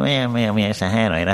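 lätt antydd gubbröst; gör en liten gubbe, trevande. Shown as a demonstration. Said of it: "Man gör en gubbe så här". (lätt spelad gubbe)

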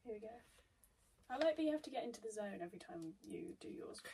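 quick hushed whisper, unvoiced; whispered aside while partner concentrates. Real, not acted. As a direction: slip the tease in a whisper.

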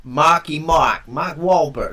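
slow, loud Boston drawl; Boston accent, Mark Wahlberg style. Pretend. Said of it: "That's awful".

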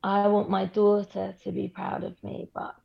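ordinary delivery

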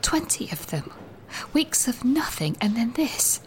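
hushed whisper so no one hears; whispering secretly. Acted. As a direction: whisper it, afraid of being overheard. I've come into the loo because it's easier.

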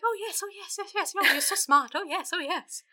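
high, soft gushing admiration; fawning Lord. Retold or imitated. Lord gushing over the lawyer's scheme, high and soft. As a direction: coo over the capitalist son. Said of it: "Lord's just like".

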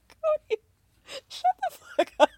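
high, squealed outburst; exasperated yell. Real, not acted. Fed-up viewer shrieking at Cody on TV. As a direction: shriek it at the screen. Yelling at TV show husband.

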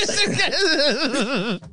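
slow, amused echo through laughter; laughing echo. Real, not acted. Host laughing as he echoes the guest's joke, slow and amused. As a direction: repeat it through a drawn-out laugh.

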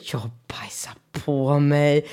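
slow secretive whisper; whispered confession. Retold or imitated. Telling her kids on the way home, slow whisper. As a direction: let the kids in on a naughty secret.

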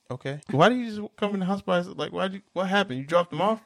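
a hint of a baffled mom; lightly voiced puzzled mom. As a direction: puzzle over it like a surprised mother. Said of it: "she was just like".

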